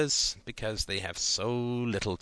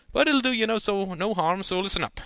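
higher, louder folksy uncle; lecturing uncle; 9 semitones higher, 4 dB louder than usual. A narrator's reading. Uncle warns nephew about Big City Ladies, folksy voice. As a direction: talk down to the boy kindly.

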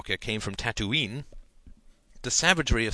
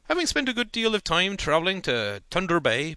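higher, louder folksy uncle voice; lecturing uncle; 7 semitones higher, 4 dB louder than usual. A narrator's reading. Uncle lecturing nephew on city women, folksy higher voice. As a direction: hold forth like a small-town uncle.

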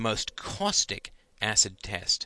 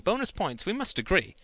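high excited; 10 semitones higher than usual. Acted. Janine's excited reply.

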